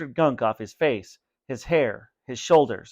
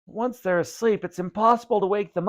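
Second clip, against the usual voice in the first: moan; 8 semitones higher than usual. A narrator's reading. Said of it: "his brother moaned".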